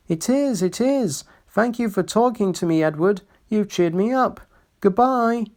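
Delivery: put-on high, gentle old engine. A narrator's reading. Old engine Skarloey voiced.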